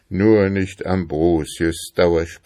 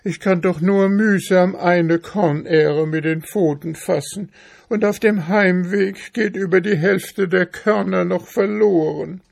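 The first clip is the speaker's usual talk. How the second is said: high-pitched, pleading little mouse; poor hungry mouse, pitched high; plaintive mouse; 12 semitones higher than usual. Acted.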